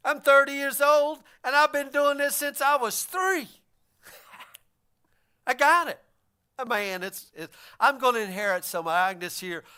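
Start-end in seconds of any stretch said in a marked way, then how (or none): 0.1-6.0 louder, proud and pleased with himself, a laugh at the end; boastful young man. Acted. Preacher acting the rich man boasting to Jesus, laughing. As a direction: brag to the teacher with a chuckle.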